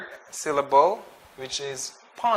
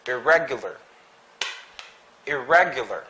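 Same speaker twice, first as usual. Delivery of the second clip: "Irregular" with stress on RE; stress on second syllable. Shown as a demonstration. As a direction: lean on RE in "Irregular", then fall. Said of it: "The stress is in the re".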